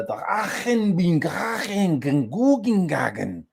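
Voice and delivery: high, drawn-out mock German; gibberish German. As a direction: play up throaty German nonsense, slowly. Improvising fake German.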